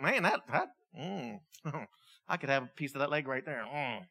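low, soft mock craving; hammed-up quiet longing for food; hungry musing. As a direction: murmur it hungrily, with appreciative "mm"s. Starving man eyeing companion's leg.

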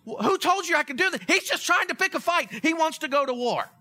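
the voice jumps up in mock panic; panicked king. King of Israel reading Naaman's letter, alarmed and panicky. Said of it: "the king of Israel freaks out".

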